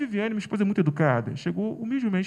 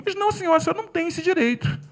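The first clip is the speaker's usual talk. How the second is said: heated protest; 9 semitones higher, faster than usual. Retold or imitated. Father argues back heatedly.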